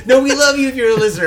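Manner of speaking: laughing through the words. Real, not acted. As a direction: giggle out the apology. Laughing after cohost's joke.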